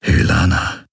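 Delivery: hushed whisper of a name; barely voiced, intimate whisper. A narrator's reading. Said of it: "flüsterte er".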